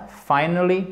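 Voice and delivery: "finally" stressed on FI, the first syllable; word stress. Shown as a demonstration. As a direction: punch the opening syllable of "finally". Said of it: "Il est ici". (« finally » accentué sur la première syllabe)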